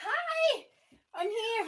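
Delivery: light, high off-camera call. Real, not acted. Calling to viewers from off camera.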